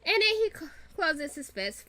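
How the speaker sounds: usual speaking voice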